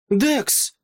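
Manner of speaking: high, sharp cry of a name; cried-out name. A narrator's reading. Deborah on the table cries out to her brother. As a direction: call out high and urgent, not at full volume. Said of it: "крикнула мама Дебора на столі".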